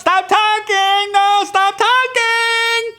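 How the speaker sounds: very high, drawn-out comic wail; hammed-up childish panic; mock horrified protest. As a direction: beg in a stretched falsetto to make it end. Acting child refusing the talk.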